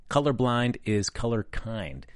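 COLORBLIND IS COLOR KIND drawn out slowly, each word weighted; sign-off slogan. Retold or imitated. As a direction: deliver "Colorblind is color kind" as a slow, emphatic tagline. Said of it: "Glenn wrapping up that session with".

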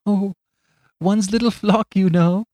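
high, mild and simple; meek little priest. Acted. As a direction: speak like a gentle country priest.

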